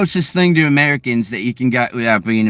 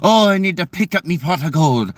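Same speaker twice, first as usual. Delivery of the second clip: hammed-up Irish lilt; bad movie Irish accent. Mocking actors in movies, exaggerated Irish accent. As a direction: overdo a stage-Irish accent. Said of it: "when people do Irish accents".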